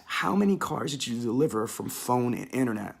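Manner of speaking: ordinary talk, nothing marked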